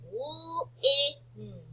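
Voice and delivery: L-I-N as three separate drawn-out sounds; sounded out. Shown as a demonstration. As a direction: sound out L-I-N slowly, phonics style. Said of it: "try spelling lin".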